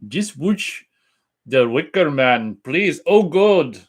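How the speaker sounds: high, loud, botched Irish accent; bad Irish accent. Shown as a demonstration. Host attempting a viewer's Irish voice, hammed-up bad Irish accent. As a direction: mangle an Irish accent like a drunk leprechaun. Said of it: "Sounds like a drunk leprechaun that visited Australia".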